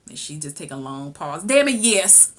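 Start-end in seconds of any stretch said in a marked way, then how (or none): 1.4-2.3 loud, high blurted answer; emphatic outburst. Real, not acted. Viewer answers question for mother, loud blurt. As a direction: burst in with the answer.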